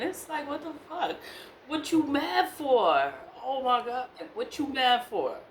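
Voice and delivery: high, incredulous what-are-you-mad-for; exasperated disbelief. Real, not acted. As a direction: throw up your hands in disbelief. Venting at the man who cursed her.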